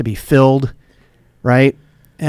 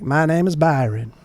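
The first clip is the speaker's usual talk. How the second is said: put-on Southern accent; 3 semitones higher than usual. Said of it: "he had, like, an accent".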